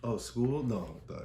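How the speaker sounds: usual speaking voice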